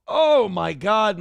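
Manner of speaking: slow, loud gushing exclamation; excited shout. Real, not acted. Streamer reacting to Marianne's donation with a loud delighted shout.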